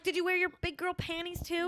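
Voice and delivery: high, snarky mocking retort; sarcastic comeback. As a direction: fire it back sarcastically. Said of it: "I'm like".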